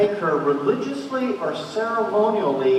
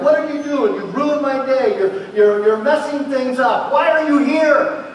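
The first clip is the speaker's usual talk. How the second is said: higher, louder mock yell of annoyed people; crowd yelling; 7 semitones higher, 7 dB louder than usual. Townspeople shout at the bleeding woman, mock yelling. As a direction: yell like a crowd shoving her off. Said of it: "They're yelling at her".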